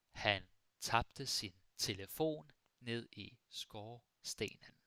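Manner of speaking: "Han tabte sin telefon ned i skorstenen" slowly, in separated chunks; slow model sentence. Shown as a demonstration. Teacher repeats sentence slowly for learners.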